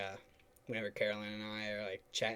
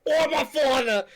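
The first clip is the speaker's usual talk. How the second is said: laughing shout; 13 dB louder than usual. Real, not acted. Laughing, shouting back at friend.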